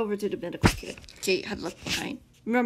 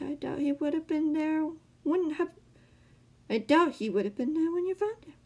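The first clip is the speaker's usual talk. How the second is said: higher-pitched, put-on musing; 8 semitones higher than usual. A narrator's reading. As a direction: ponder it in a high villain voice. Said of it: "The man mused".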